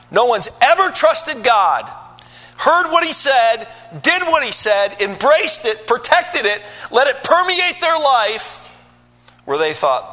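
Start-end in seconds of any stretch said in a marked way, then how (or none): 0.1-8.6 rising, loud preaching build; climbing sermon cadence; preaching at full force. Acted. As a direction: preach it louder and higher line by line.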